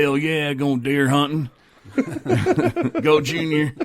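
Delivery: hammed-up hollering race fan. Whooping as his old drinking self.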